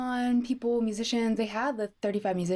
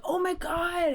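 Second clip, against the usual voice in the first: excited gasp; 8 semitones higher, slower than usual. Retold or imitated. Excited quote of reading comment.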